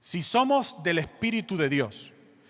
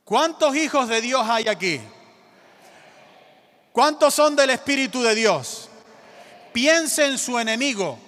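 loud, higher preacher's call; rally the church with a raised voice; rousing call; 4 semitones higher, 7 dB louder than usual. Real, not acted. Preacher asks the church who are God's children, rousing call.

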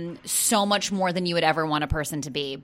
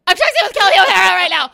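high, loud and fast, laughing through it; giddy excitement; 10 semitones higher, 9 dB louder, faster than usual. Retold or imitated. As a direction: spill it out like starstruck news. Gushing over texting a star.

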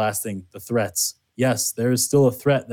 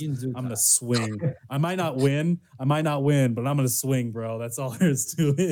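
higher, softer defiant bro boast; frat bro; 4 semitones higher, 4 dB quieter than usual. Acted. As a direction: swagger it out, half joking.

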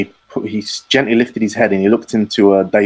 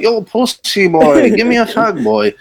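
high, slow mock roadman jeer; rude boy taunt; 12 semitones higher than usual. Rude boys taunt the hairdresser, high slow mock jeer. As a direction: sneer it like a cocky rude boy. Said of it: "They're like".

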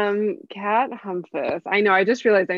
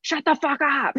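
higher, mock irritated retort; mock agitated snap; 7 semitones higher than usual. Guest imitating her irritated reply to family, mock snap. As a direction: fake snapping at family. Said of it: "I can tell I'm agitated".

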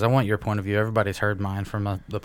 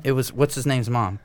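normal talking voice

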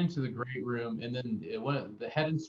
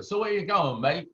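higher, louder British cabbie voice; 5 semitones higher, 5 dB louder than usual. Retold or imitated. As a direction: play the chatty London cab driver. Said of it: "the taxi driver's like".

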